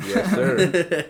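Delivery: high, laughing agreement; laughing yes sir. Real, not acted. Host laughing in agreement with the guest's joke.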